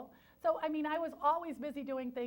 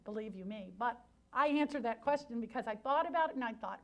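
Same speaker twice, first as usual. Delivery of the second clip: ordinary talk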